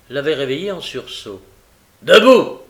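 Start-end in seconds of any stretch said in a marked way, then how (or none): 2.0-2.6 loud, higher one-word shout; harsh command. A narrator's reading. Sykes waking the girl, commanding shout. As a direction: order her up abruptly.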